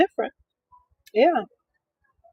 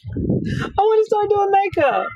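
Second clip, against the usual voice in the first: whiny repeat, higher and slow; higher, slower whiny plea; 10 semitones higher than usual. Retold or imitated. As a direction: drag it out pleading. Repeats dream, drawn out.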